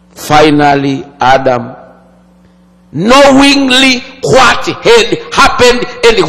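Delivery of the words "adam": usual speaking voice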